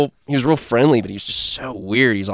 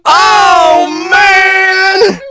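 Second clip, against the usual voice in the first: high shouted cry; hammed-up drunk howl; mock wino wail; 9 dB louder than usual.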